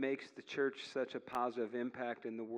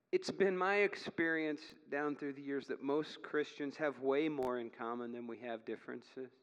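usual speaking voice

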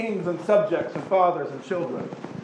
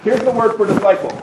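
ordinary talk, nothing marked

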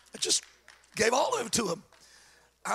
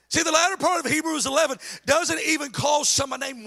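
loud, high preaching voice; full-force sermon delivery; 7 semitones higher, 4 dB louder than usual. Acted. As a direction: preach it out to the room.

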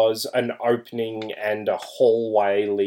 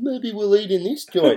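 high-pitched put-on musing; mock bogan group; 10 semitones higher than usual. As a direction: imitate clueless wanderers, pitched well up. Mimicking the hick bogans wandering in.

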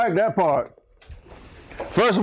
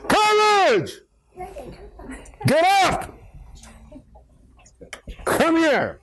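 very high, emphatic calling out; enthusiastic, ringing preacher's cry; heavy emphasis; 10 semitones higher, slower than usual. Shown as a demonstration. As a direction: hit each command hard, pitched way up. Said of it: "heavy emphasis was placed on it".

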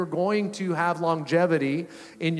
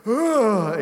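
effortful groan, pitched up; straining for sound; 5 semitones higher, 4 dB louder than usual. Retold or imitated. Hurt skier trying to call ski patrol, strained groan. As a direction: push a groan out with all your breath. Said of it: "get some sound out of my mouth".

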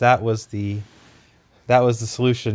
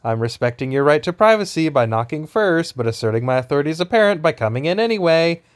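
Cosmo-style parent voice; 6 semitones higher than usual. Retold or imitated. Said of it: "where he's like".

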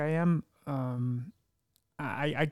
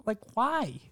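shocked to find yourself happy, pitched way up; baffled surprise; 12 semitones higher than usual. Retold or imitated. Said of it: "I'm like".

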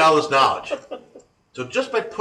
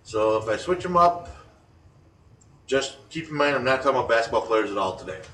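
ordinary delivery

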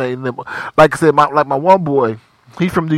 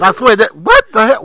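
ordinary delivery

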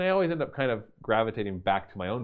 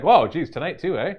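mild mock astonishment, raised pitch; louder, higher, amused disbelief; retold surprise; 8 semitones higher, 10 dB louder than usual. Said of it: "I'm just like".

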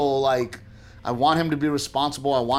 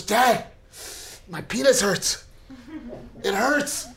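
a hint of a complaining little boy, higher pitched; lightly voiced young son; 7 semitones higher, slower than usual. Son complains to dad on waking, lightly imitated child voice. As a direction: imitate a kid reporting pain to dad.

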